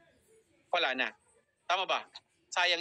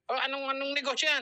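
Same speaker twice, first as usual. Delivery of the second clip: higher, imitated irritated challenge; mock a cross boyfriend demanding answers; mock angry; 7 semitones higher than usual. Boyfriend questioning the business, mock angry. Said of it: "Nagalit, nag-reply ulit".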